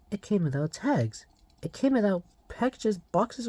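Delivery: ordinary delivery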